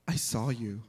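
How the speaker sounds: low, deadpan imitation of a kid. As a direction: drop it in a deadpan tone. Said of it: "deadpan tone".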